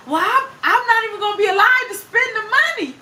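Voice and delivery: high, loud mock protest; upset husband. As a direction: wail the objection as the indignant husband. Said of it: "He was so mad".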